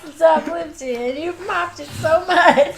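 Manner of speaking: loud, high, laughing mock complaint; retold complaint through laughter. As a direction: complain loudly while cracking up. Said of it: "He was like".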